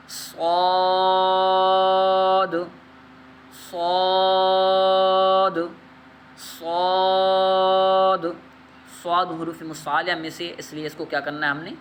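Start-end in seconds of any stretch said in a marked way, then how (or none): 0.1-8.3 صاد stretched very long, thick and full-mouthed; صاد drawn out heavy, a little louder; heavy letter. Shown as a demonstration. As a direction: make صاد heavy and slow. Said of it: "موٹا پڑھنا ہے".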